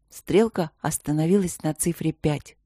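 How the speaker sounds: ordinary delivery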